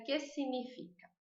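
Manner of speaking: que significa said softly, g silent; model: si-ni-fi-ka; quiet correct que significa. Shown as a demonstration. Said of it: "Mas a gente pronuncia".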